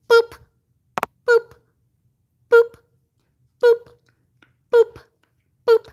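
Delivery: high, loud squeaky boop; playful boop. Real, not acted. Owner sending boops to the stream chat, a high squeaky boop. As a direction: pop it out like a squeak toy.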